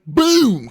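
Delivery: loud, high comic boom; crash sound effect. Retold or imitated. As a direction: shout the impact like a cartoon. Imitates the crash.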